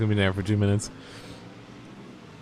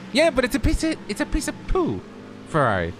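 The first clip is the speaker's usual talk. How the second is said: much higher squeaky mock voice; 14 semitones higher than usual. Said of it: "when they were just like".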